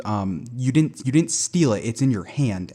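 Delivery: usual speaking voice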